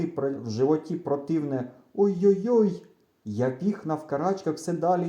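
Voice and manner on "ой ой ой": higher, louder worried "oi-oi-oi"; anxious moan. A narrator's reading. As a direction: wail it with a queasy dread. Groaning from a queasy belly.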